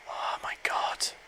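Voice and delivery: breathless whispered disbelief. Real, not acted. To viewers as the game turns, whispered disbelief. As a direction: hush it out in disbelief. Whispered reaction to close call.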